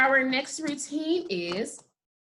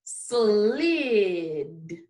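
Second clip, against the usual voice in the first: long, gliding, lower-toned slid; say it slowly; 3 semitones lower than usual. Shown as a demonstration. Teacher's turn again for the class, slowly stretched. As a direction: stretch slid out so each sound is heard. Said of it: "it's time for say it slowly".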